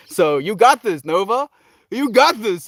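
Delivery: a coach's real hype for Nova; loud encouragement. Real, not acted. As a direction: pump her up. Cheering on a struggling viewer.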